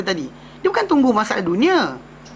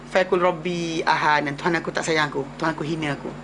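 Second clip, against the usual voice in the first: lower, softer, hurt grumble; complaining believer; 8 semitones lower, 5 dB quieter than usual. Retold or imitated. Preacher voicing the man who says God despises him, low and dejected. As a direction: mope like someone who feels unloved. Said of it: "dia kata apa?".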